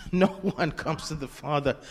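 quoting scripture through a real laugh; a laugh breaks into the words; chuckling with delight at the verse. Real, not acted. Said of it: "I'm laughing because I'm just thinking".

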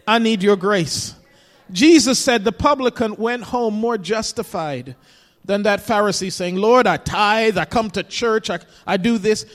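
loud, driving sermon voice; full-force preaching. Acted. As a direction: preach it hard to the congregation.

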